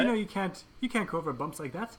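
high, loud scolding; Judi Dench scolding. Acted. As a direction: scold him like a grand dame. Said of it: "I did a pretty mean Judi Dench".

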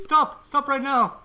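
very high, loud shriek; Judi Dench shouting. Acted. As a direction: shriek at him to stop. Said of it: "I did a pretty mean Judi Dench".

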